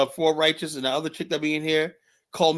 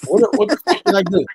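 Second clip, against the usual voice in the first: exasperated outburst; 5 semitones higher, 6 dB louder than usual. Real, not acted. Baffled at being reported.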